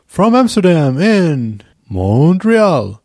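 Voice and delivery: stretched, high announcer call; sing-song show opener. Acted. Host kicks off the podcast in a drawn-out sing-song. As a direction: ham up going live.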